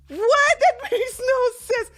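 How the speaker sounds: high, loud incredulous squeal with a laugh; a loud, shocked what that breaks into laughter. Retold or imitated. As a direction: shriek it in disbelief, laughing. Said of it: "And I'm like".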